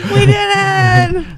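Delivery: laughing, slow high cheer. Pretend. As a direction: celebrate through laughter. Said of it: "We're all just, like, cheering".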